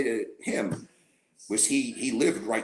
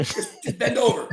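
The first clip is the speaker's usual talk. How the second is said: high, loud teasing voice; comic high-pitched taunt; mock joke voice; 13 semitones higher, 5 dB louder than usual. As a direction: ham up the gag. Joking gym spotting scene.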